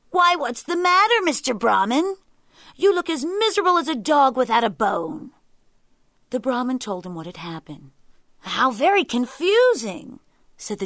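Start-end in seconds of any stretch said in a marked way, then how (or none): 0.1-5.3 high, loud, animated jackal voice; lively jackal. A narrator's reading. 8.4-10.2 higher, louder, drawn-out puzzlement; confused jackal. A narrator's reading. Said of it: "For I am very confused".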